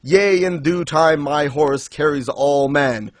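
imitating a solemn, proud rider of legend; grandiose Death. Death refusing three riders his horse, grandiose retold speech. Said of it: "he's basically saying".

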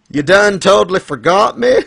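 put-on indignant Southern girl, high and loud; mock-pissed Southern twang, rising into the question; Southern accent. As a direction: play up a wronged Southern girl. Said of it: "I kinda like Southern girls".

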